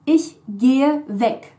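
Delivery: Ich gehe weg said slowly, each word clear; slow careful model sentence. Shown as a demonstration. Teacher modelling Ich gehe weg, slow and clear. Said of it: "but instead to".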